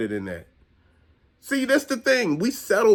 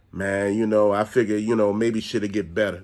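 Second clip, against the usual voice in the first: mock mope; 9 semitones lower than usual. Mimicking someone staying with cheater.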